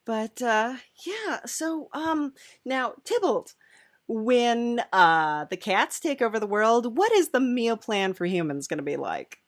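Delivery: ordinary delivery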